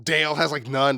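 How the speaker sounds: ordinary talk, nothing marked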